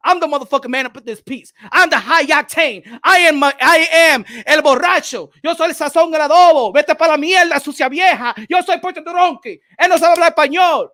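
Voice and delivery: shouted Spanglish bragging; loud hype boast. Acted. Host boasts over Stephen A, loud hype shouting. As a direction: belt it out like a proud hype man.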